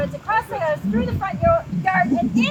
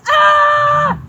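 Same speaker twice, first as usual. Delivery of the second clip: high loud cry of alarm; yelled scream; 11 semitones higher, 13 dB louder than usual. A narrator's reading. Megan yells as bugs swarm her, high shriek. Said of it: "Megan yelled".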